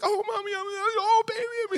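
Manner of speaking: very high, wailing reunion voice; hammed-up sobbing squeal; mock crying. Child and mother reunited, hammed-up crying.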